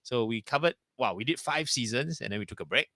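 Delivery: ordinary delivery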